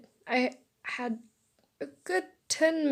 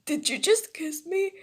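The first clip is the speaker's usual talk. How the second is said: higher, startled question; flustered shock; 6 semitones higher, 4 dB louder than usual. Acted. Boy to the girl who just kissed him, shocked. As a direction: ask it in rising disbelief.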